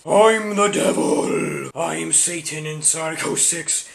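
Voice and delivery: slow booming satanic game voice; devil voice. Pretend. The game answers the reviewer as a slow evil devil. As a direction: drag out each word like the devil himself.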